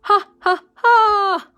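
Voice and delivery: high, loud hearty ha ha; big belly laugh; roaring laugh. Acted. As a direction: roar with laughter as the dragon. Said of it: "roared the dragon".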